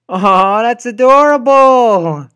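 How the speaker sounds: very high, loud gushing; overwhelmed by cuteness; squealing delight; gushing aww. Real, not acted. Gushing at cute scene.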